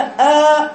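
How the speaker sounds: slow, thick "آ" with the mouth opened upward; long dark "آ", demonstrated as over-heavy; tafkheem. Shown as a demonstration. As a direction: raise the jaw and hold a heavy "آ". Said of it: "يبقى ده تفخيم".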